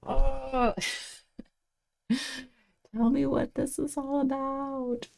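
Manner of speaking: playful imitation of a clingy mind; mock pleading. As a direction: beg the feeling to explain itself, half laughing. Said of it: "holding on to that emotion and being like".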